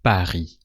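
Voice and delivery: "Paris" in French, no S at the end; silent S. Shown as a demonstration. Teacher demonstrating that the last letter is not pronounced in "Paris", silent S. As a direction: drop the final consonant of "Paris", ending on ee. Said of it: "so you don't pronounce it".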